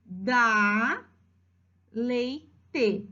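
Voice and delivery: DÁ LEITE drawn out with pauses, lei-te separated; syllable by syllable. Shown as a demonstration. Teacher pointing out the child's letters, syllable by syllable. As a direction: stretch DÁ and break LEITE in two.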